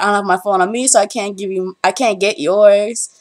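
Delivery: ordinary delivery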